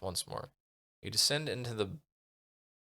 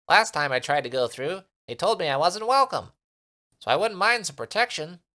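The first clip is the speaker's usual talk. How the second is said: high, loud little creature voice; eager little creature asking for protection; tiny Minimite creature; 9 semitones higher, 8 dB louder than usual. Acted. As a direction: squeak it out like a tiny sprite.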